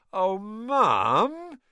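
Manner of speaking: high, loud whiny protest; a teenager groaning at unfair chores; protesting son. A narrator's reading. Sons protesting to their mother about de-gnoming, loud and whiny.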